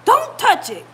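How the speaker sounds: loud, high mock commands to dogs; husband's frantic warning, imitated; imitated yelling. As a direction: shout them away from danger. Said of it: "He was yelling at him too".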